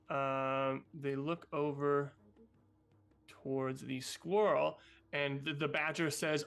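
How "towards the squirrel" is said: normal talking voice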